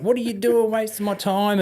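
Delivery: high, mock furious officer; imitating the XO snapping at them; retold angry outburst. XO berating the two soldiers, mock furious. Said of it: "lost his shit at them".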